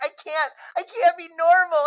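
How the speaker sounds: high squealing giggly protest; a thirteen-year-old too excited to act normal; squeaky excited I can't be normal; giddy teen. Retold or imitated. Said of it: "And Bobby's like".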